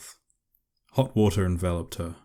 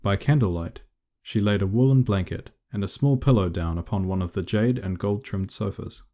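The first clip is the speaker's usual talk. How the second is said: usual speaking voice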